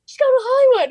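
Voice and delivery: high, loud announcement that she made it; excited announcement. Retold or imitated. [name] telling the singer she is going to Hollywood, excited.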